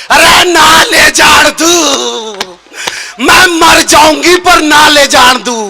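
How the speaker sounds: aunt's long-drawn melodramatic plea; hammed-up pleading wail. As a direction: wail slowly, stretching the final word. Aunt at his feet, pleading dramatically.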